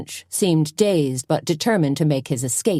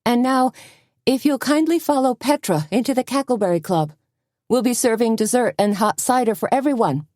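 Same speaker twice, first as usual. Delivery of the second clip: hostess announcement; 7 semitones higher than usual. A narrator's reading.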